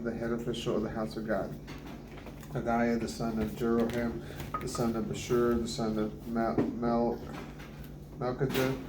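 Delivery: ordinary delivery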